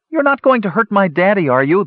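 put-on high-pitched little girl voice. A narrator's reading. Little girl to Tannen about her daddy, challenging. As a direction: challenge him in a small girl's high voice.

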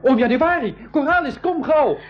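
high, urgent calling out; desperate high call to uncle. Acted. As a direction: shout for help across the deck. Boy calls adults for help.

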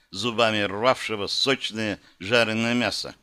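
usual speaking voice

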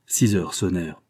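plain reading voice

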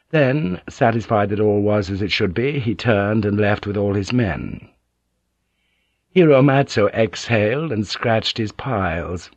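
ordinary delivery, nothing marked

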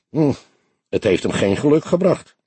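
ordinary delivery, nothing marked

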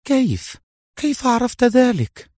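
very high-pitched, amazed woman's voice; astonished question. A narrator's reading. Mona asking Adham how he knew, astonished. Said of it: "وسألته بصوت خافت أيضًا".

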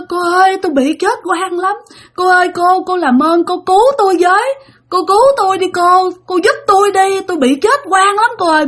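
high, wailing plea of a dead woman; crying ghost woman. Retold or imitated. Old ghost woman begging the girl for help, high and tearful. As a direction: beg through tears like a wronged ghost. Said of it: "Mà vừa nói vừa khóc nha".

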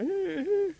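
very high, loud whimpering wail; keen in short high bursts; imitated wailing. Rabbit heard from the hill during breakfast, high wailing. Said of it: "he can hear the sound".

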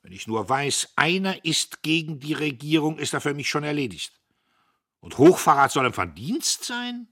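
loud outraged lecture to mother and sisters; angry rant. A narrator's reading. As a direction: rail at them, fully worked up. Dieterich rages at women.

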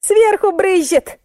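high, slow cry of explanation; high heavy-breathing explanation. A narrator's reading. Client explains the spray to narrator, high and strained. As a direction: call it out high and drawn out. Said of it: "тяжело дыша объяснила клиентка".

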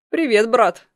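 cocky, loud greeting; brazen. Acted. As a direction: greet him with open insolence. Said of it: "нагло прозвучал его голос".

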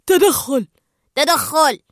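loud urgent shout, repeated. Acted. Anchor and Chum shout for an intervention. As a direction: yell for help to stop him. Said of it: "فصاح آنكر وتشام معًا".

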